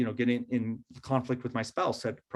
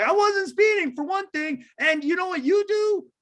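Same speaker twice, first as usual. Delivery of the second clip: much higher, louder indignant comeback; defensive retort; 4 dB louder than usual. Retold or imitated. Speeder firing back at neighbor, indignant and high. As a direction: snap back in offended defense. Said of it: "I'm just gonna come at you and be like".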